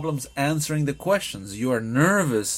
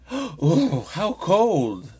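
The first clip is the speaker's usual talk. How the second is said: feeling the cold, higher drawn-out "how cold"; raised, emphatic reaction to the chill; emphatic exclamation; 4 semitones higher, much slower than usual. Shown as a demonstration. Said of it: "I can go outside and I can say".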